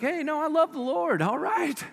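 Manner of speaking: nervously change your tune; hammed-up sudden piety; mock backpedal. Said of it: "we're like".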